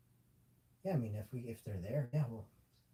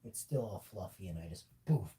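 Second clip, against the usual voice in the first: ordinary delivery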